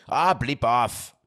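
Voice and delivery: slow, loud, put-on English accent; mock English accent; attempted English accent. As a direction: drawl it like a cheeky Londoner. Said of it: "Did I do that well?".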